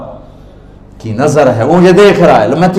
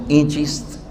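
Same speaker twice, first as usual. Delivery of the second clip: innocent question; 9 dB quieter than usual. Acted. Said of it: "بڑے بھول پن میں کہتے ہیں".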